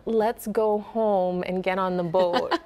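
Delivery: slow, rounded Minnesota O in boat. Shown as a demonstration. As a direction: stretch the home and boat vowels. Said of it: "It was like".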